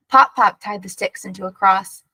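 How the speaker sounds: ordinary delivery, nothing marked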